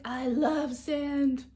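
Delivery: very slow, spaced-out surfer drawl; dreamy surfer dude. Retold or imitated. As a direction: stretch it out like a stoned surfer. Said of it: "He's like".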